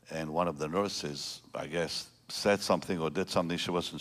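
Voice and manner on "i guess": normal talking voice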